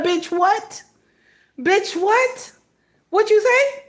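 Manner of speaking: high-pitched mock outrage; incredulous. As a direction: balk at it in high, rising disbelief. Imagines being sent onto the roof.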